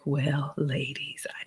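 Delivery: confiding whisper to the ladies. Real, not acted. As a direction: lean in and share a secret in a whisper. Confiding a secret to viewers.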